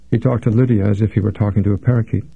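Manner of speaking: usual speaking voice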